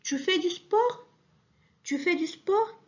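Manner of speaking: "sport" rising high at the end; rising question. Shown as a demonstration. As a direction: ask it, pitch climbing on "sport". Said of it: "غيرنا غير في النطق". (سؤال بنبرة صاعدة على "sport")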